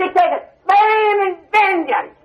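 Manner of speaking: slow, high, shouted warning of wrath; thundering shout. Acted. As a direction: roar out the vengeance slowly. Preacher thundering about judgment.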